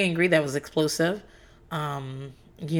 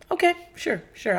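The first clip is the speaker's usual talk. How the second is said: high sarcastic okay sure; fake-agree with a high sing-song; 9 semitones higher than usual. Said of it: "mock acceptance".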